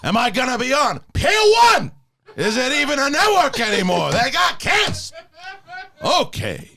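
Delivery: yelling Al Pacino. Acted. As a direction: rant like late-career Pacino. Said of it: "The yelling?".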